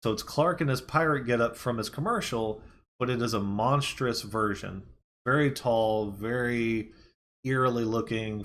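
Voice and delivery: usual speaking voice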